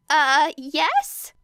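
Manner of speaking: a nervous high yes; Hizashi voice. Acted. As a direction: Hizashi caught off guard. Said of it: "answered Hizashi".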